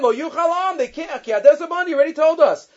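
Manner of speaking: high-pitched, insistent objection; acted protest. Moshe tells Hashem he was already told, acted protest. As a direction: argue back, pitch jumping up.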